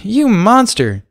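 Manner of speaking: slow, high, loud mock horror; hammed-up accusation; mock outrage. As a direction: groan at the pun dramatically. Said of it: "exclaimed Derpy".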